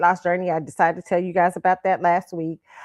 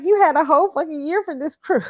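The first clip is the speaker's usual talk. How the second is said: exasperated rant; 8 semitones higher, 4 dB louder than usual. Retold or imitated. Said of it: "That's my Virgo moon".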